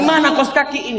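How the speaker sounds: high mock-cross demand; angry husband. Husband hunting socks before work, mock angry. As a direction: raise the pitch, lightly imitating irritation. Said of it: "Kalau suami marah".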